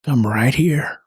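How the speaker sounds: low, hushed, slow comfort; tender reassurance. Acted. Soothes the upset child.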